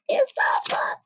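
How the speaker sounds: breathy awed whisper. Real, not acted. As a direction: hush it in wonder. Awed whisper after excitement.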